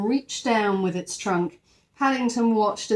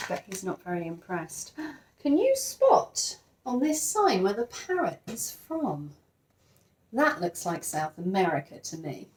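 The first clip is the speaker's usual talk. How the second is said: ordinary delivery, nothing marked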